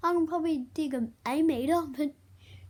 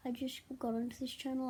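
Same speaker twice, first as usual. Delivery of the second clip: whisper; 8 dB quieter, faster than usual. Real, not acted. Muttering aside to himself.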